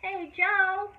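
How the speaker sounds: high call across the yard. A narrator's reading. Mama Sue calling Joe from the porch, loud high call. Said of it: "Mama Sue called".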